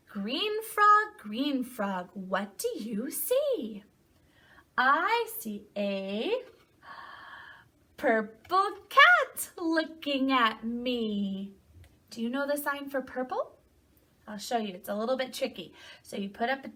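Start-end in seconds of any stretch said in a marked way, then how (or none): 0.1-11.5 slow, lilting read-aloud with stretched words; storybook reading to children. A narrator's reading. Teacher reading a picture book to children, slow singsong storytelling. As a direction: read slowly, swooping up on key animal names.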